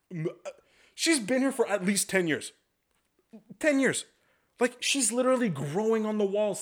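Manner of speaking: high-pitched indignant rant; mock shrill rant. Bartender ranting to himself about the senior waitress, high and indignant. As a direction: let the outrage squeak up high the whole way.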